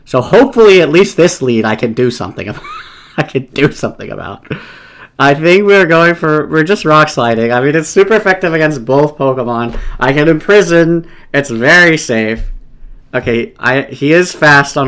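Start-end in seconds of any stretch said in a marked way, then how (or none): none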